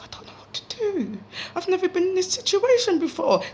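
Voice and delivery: high, breathless panicked thoughts, first line whispered; panicked inner voice. Retold or imitated. Telling her brother with her eyes she's lost, high panicked voice. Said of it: "this telepathic conversation".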